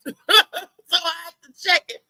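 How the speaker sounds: laughing through the words; giggling, a little slow. Real, not acted. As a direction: break into a laugh while speaking. Laughing at being blocked.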